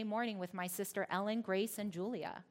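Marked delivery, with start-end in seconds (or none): none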